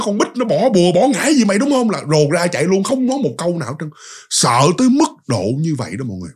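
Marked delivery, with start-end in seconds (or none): 0.0-1.9 pitched well up, pointed mock accusation; higher accusing question. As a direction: ask it higher and sharper, pressing him. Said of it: "vừa nghe nó". (hỏi giọng cao hơn, gặng hỏi)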